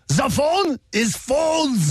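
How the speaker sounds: slow, lightly voiced German accent; mock German accent. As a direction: drag it out in a faint German accent. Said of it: "if it was a German accent".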